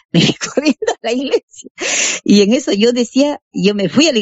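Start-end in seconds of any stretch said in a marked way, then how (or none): 0.1-1.7 laughing. Retold or imitated. Repeats it laughing.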